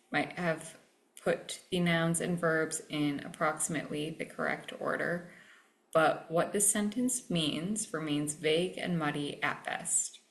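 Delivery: usual speaking voice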